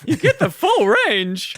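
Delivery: high squeaky mimic of a cracked voice; mock voice crack. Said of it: "my voice cracked".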